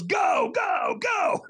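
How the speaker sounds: high-pitched, imitated trainer's shout; urgent shouting. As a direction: urge the runner off, cheering hard. Trainer urging him onto the coals.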